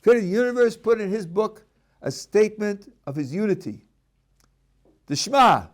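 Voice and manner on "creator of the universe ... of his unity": ordinary delivery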